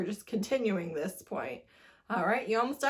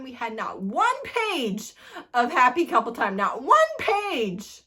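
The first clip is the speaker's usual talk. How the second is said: indignant outcry; 6 dB louder than usual. Real, not acted. Reviewer complains to viewers, outraged shout. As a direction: let the outrage burst on NOT ONE PAGE. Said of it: "ranting about the ending".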